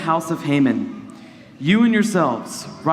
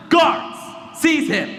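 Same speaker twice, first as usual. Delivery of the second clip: roaring tyrant king; 10 semitones higher than usual. Acted. King orders Haman's arrest.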